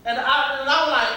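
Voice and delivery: ordinary talk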